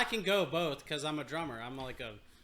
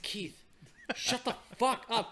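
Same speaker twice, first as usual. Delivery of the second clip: hushed plea; 6 semitones higher, slower than usual. Retold or imitated. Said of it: "I was like".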